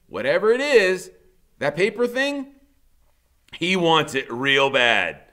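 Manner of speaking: ordinary delivery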